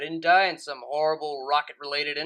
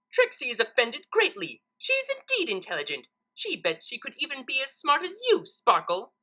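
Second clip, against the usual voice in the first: higher, huffy and haughty; offended Trixie; 7 semitones higher than usual. Acted. Trixie answering Twilight's insult, haughty and huffy. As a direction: puff up with wounded pride.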